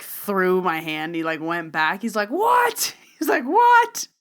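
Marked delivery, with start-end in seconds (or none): 2.3-2.9 high, loud shriek of disbelief; imitated freak-out. Man reacting to the palm reading, freaking out. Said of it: "freaking out". 3.4-4.1 high, loud shriek of disbelief; imitated freak-out. Man reacting to the palm reading, freaking out. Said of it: "freaking out".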